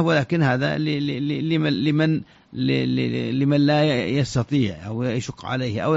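ordinary talk, nothing marked

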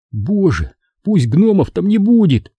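fervent plea climbing high; silent praying. A narrator's reading. Boy prays while father looks out, silent praying. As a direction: beg God under pressure. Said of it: "молил я про себя".

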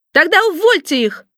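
high-pitched, furious little boss; shouted order. A narrator's reading. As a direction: cry out the order in a piercing voice. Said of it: "закричал шеф".

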